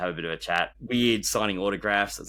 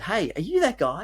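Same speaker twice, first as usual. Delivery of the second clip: high-pitched curious stranger spotting someone; much higher, eager question; excited fan; 11 semitones higher than usual. Retold or imitated. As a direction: call it out like a fan who recognises a face. Excited fan recognising speaker.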